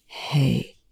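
soft, quiet hail; hushed, steadying word; said quietly. A narrator's reading. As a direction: say it gently to get his attention. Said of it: "Telford said quietly".

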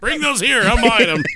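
high, pushy and eager; snatch the deal, pitch up; eager mock buyer. Acting out eager girlfriend buying.

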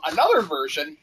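ordinary talk, nothing marked

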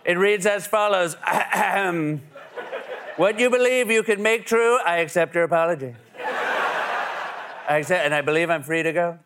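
hammy formal courtroom read; Trump impression. Mock Trump reading fortune.

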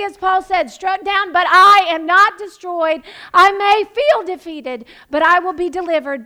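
loud, rising, hammered declarations; full-force preaching. Acted. Pastor rallies the congregation to claim victory, loud preaching. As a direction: preach it like a battle cry.

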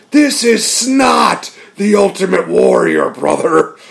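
hammed-up Hogan bellow; Hogan promo impression. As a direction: bellow it like a wrestler cutting a promo. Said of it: "He's going".

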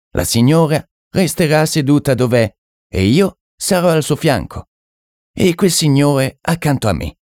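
high-pitched occultist taking charge; French medium. Acted. French medium seating the séance sitters, high-pitched and in command.

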